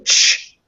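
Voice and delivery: "ch" as a T running into sh; ch sound. Shown as a demonstration. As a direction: say a voiceless "ch" on its own, T released into sh. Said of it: "starts as a T but ends as a sh".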